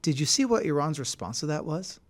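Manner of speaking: ordinary delivery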